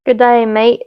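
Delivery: amateur Aussie accent; Australian accent. Shown as a demonstration. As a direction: greet in a put-on Australian accent. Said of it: "How do they talk?".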